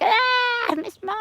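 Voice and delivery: very high, falsetto mock leer; imitating men in an Australian pub; pub men's leer. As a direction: ham up a leering pub bloke. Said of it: "They would just be like".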